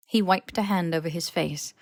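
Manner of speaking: ordinary delivery, nothing marked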